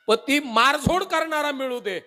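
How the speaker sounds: mother's pleading. Retold or imitated. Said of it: "आई सांगते".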